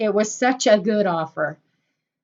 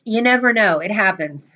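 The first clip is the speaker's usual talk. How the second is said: usual speaking voice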